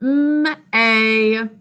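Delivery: "M, A" as a held mmm, then long ay; "M, A" drawn apart, evenly held; separate letter sounds. Shown as a demonstration. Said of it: "What sounds do you hear".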